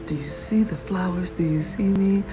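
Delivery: ordinary talk, nothing marked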